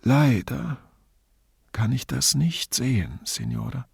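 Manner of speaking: mildly wistful, with a weak smile; faint smile. A narrator's reading. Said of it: "sagte mit schwachem Lächeln".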